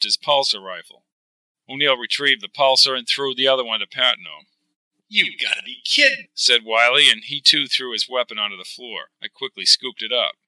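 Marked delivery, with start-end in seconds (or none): 5.1-6.3 much higher, incredulous cry; disbelieving. Acted. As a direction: react in shock. Wiley shocked, disarmed.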